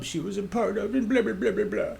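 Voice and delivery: high-pitched imitation of pretentious prattle; mocking chatter. Mimics lady's pretentious book-club chatter.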